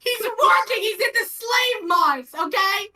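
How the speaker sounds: high, loud, slow mock indignation; mock outrage. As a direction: ham up the outrage. Mock outrage defending partner.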